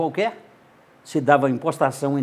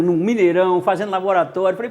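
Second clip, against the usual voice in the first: ordinary talk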